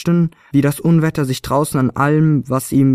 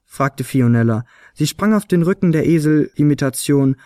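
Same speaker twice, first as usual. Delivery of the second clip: ordinary delivery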